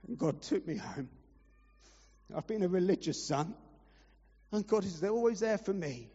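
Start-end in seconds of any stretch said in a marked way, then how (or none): none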